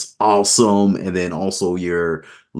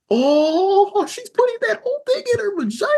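shocked squeal. Retold or imitated. Retelling his shocked reaction.

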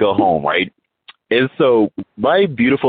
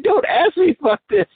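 very high, whiny pleading; mock wailing plea. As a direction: beg off in an exaggerated wail. Recalls dreading wife's eager questions.

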